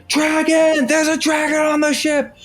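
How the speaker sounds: high, loud panicked cry; panicked guards. Acted. Guards shouting in panic at the dragon. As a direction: scream the alarm at the dragon. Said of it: "they go".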